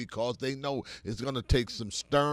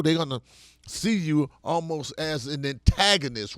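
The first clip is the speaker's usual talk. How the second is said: ordinary delivery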